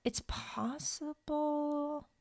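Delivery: high, soft and very slow; drawn-out pondering. Real, not acted. Co-host checking whether the writer did both comics, drawn-out pondering. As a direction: stretch it out while searching for the answer. Unsure, stalling while checking.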